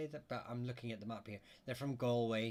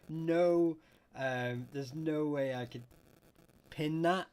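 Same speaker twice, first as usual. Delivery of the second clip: emphatic laughing no; 5 semitones higher, 8 dB louder, slower than usual. Real, not acted. Laughing apology to viewer.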